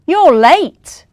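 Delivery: loud, high furious shout; cross little elf yelling at the latecomer; angry little elf. Acted. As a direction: tell him off sharply. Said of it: "an angry little elf said".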